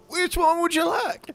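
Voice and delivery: very high cartoon voice; SpongeBob movie characters. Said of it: "those two guys in the".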